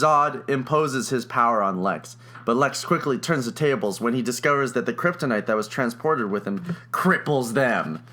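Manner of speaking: usual speaking voice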